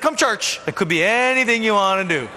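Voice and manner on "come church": much higher, bright invitation; eager invite. Retold or imitated. Inviting a friend to church, much higher. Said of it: "You're like".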